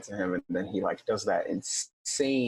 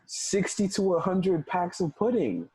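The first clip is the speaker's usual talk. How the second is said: laughing; 8 semitones higher than usual. Real, not acted. Friend recapping a pudding-buying scene, laughing. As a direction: laugh through the punchline.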